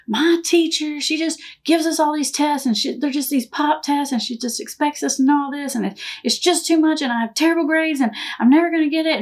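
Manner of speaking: imitated kid venting, high run-on complaint; child's meltdown. Son complains to mom about his teacher, mock meltdown. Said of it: "going into this meltdown".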